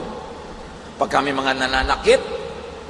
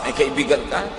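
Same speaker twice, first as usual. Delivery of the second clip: ordinary talk, nothing marked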